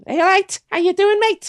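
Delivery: high, bright-pitched Scouse greeting; Liverpool accent. Retold or imitated. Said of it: "the Liverpool".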